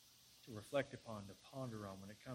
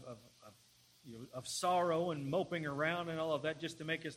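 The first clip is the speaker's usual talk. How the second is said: raised, louder pulpit delivery; impassioned preaching; 5 semitones higher, 8 dB louder than usual. Real, not acted. Preacher urging the congregation to confess and praise God, impassioned preaching. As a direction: preach it with mounting force, easing at the end. Preaches, rising into a model prayer.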